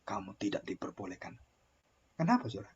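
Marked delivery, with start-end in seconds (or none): 0.1-1.3 much softer, lower, grave pronouncement; voice of God. Acted. God quietly tells Moses he cannot enter the land. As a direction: hand down the sentence in a hush. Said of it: "Dan Tuhan beritahu".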